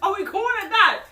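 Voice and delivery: loud, high impatient demand; retold snapping. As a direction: act out snapping at her. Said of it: "he kinda snaps on her".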